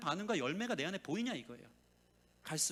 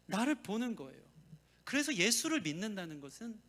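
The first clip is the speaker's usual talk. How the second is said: usual speaking voice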